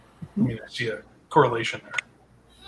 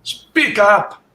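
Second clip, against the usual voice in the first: loud, higher call; 7 semitones higher, 6 dB louder than usual. Real, not acted. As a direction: call out loudly to someone you can barely hear. Calling to a faint caller.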